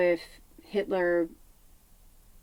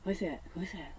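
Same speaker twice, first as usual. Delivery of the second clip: whispered, soft repeated question; hushed asking; 14 dB quieter, much faster than usual. Retold or imitated. Quietly asking a friend in the church pew. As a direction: lean in and ask quietly. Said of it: "I'm like".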